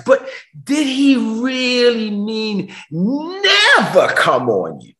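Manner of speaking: "never" stretched long and slow; slow and heavy on "never"; drawn-out preaching question. Acted. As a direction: draw out "never", then punch the end. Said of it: "preacher repeats the question".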